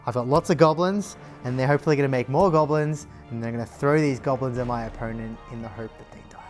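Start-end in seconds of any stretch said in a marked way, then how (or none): none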